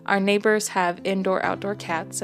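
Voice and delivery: ordinary delivery, nothing marked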